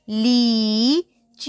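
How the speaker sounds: लीची stretched very slowly, long ee held; slow drawn-out reading. Shown as a demonstration. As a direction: read लीची slowly for a learner. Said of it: "तो आपने वैसी ही आवाज़ निकालनी है इसकी".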